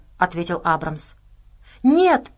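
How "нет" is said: high, loud cry of refusal; exclaiming. A narrator's reading. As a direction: exclaim it as a girl's protest, pitched up. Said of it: "воскликнула девочка". (громкое, высокое восклицание)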